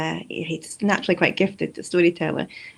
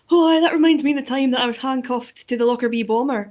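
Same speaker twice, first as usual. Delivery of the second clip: loud, raised-pitch Aberdeenshire storyteller; broad Aberdeenshire accent; 4 semitones higher, 6 dB louder than usual. Retold or imitated. Storyteller topping his colleague's tale, broad Aberdeenshire accent. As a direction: put on a broad Aberdeenshire brogue. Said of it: "like, really broad Aberdeenshire accent".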